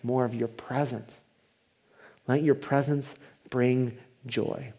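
quiet devoted prayer. Shown as a demonstration. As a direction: pray it gently and sincerely. Prayer spoken as example.

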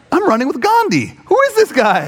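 high-pitched disbelief; mock-astonished, voice climbing at the end; incredulous outburst. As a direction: marvel in comic exasperation. Amazed at encouraging fellow runner.

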